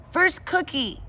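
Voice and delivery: put-on high toddler voice, insistent; a stubborn small boy wanting his cookie first; little Fudge voice. Toddler Fudge insisting to Janet, high and stubborn.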